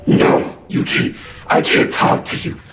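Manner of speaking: harsh, breathy unvoiced demon rasp; imitating a scratchy demon voice on the phone. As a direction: growl it out like something possessed. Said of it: "a loud, scratchy demon voice on my phone".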